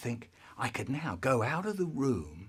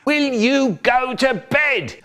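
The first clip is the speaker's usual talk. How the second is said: loud, slow shouted order; brother shouting; 12 semitones higher, 11 dB louder, slower than usual. Retold or imitated. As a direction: bellow it at the kid. Brother ordering him to bed.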